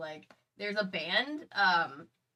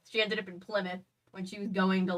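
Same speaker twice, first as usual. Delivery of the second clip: ordinary talk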